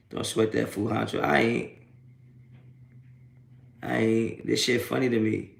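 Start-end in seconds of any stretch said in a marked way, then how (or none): none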